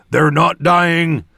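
loud, drawn-out battle cry; shouted. A narrator's reading. Torum shouts to his companion while fighting undead, shouted. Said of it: "Torum shouted".

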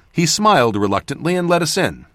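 usual speaking voice

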